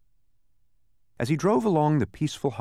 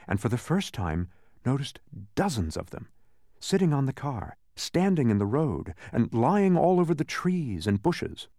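plain reading voice

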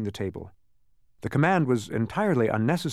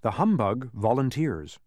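ordinary delivery

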